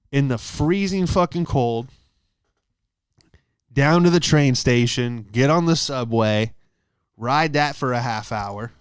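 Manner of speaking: ordinary talk, nothing marked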